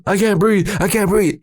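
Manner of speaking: high-pitched panicked pleading; retold freak-out. Teen tells his brother he can't breathe, high panic. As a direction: whine it out in mock panic. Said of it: "I'm, like, freaking out".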